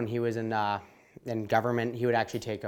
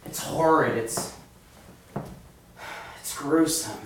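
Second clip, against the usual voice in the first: period character voice; 3 semitones higher than usual. Acted. Actor in period role.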